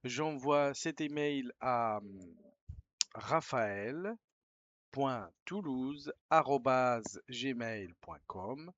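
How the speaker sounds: reading an email aloud slowly, word by word; slow dictation. Shown as a demonstration. Teacher dictating an email to students, slow and clear. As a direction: spell it out as you dictate.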